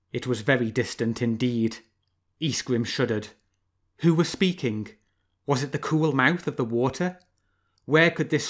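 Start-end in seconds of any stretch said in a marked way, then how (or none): none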